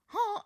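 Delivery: very high goose honk. Pretend. Showing off her honk again, high goose honk. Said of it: "I love honking".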